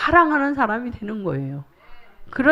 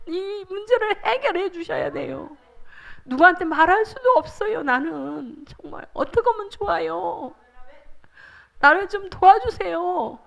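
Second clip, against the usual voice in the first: higher, breaking into sobs as she pleads; crying prayer; 5 semitones higher than usual. Shown as a demonstration. Preacher shows the congregation how to weep in prayer. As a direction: sob out the plea to God. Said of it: "울면서 기도해야 돼".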